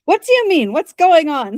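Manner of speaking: acted bewildered protest; flustered questioning. A narrator's reading. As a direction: blurt it with pitch leaping way up. Flustered sister in acted scene.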